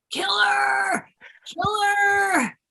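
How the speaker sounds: high, loud mock yell of the crowd; imitating screaming crowd. Recalling theater audience screaming at her character, high mock yell. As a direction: shout it like an angry audience. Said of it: "people were screaming".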